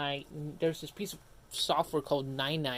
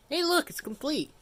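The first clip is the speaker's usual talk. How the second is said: excited; 12 semitones higher, 6 dB louder than usual. Real, not acted. PC setup finishes, streamer cheers.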